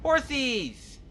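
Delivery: high, drawn-out gleeful squeal. Real, not acted. Player spotting horses by the road, childlike delight. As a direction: call out the horses with glee.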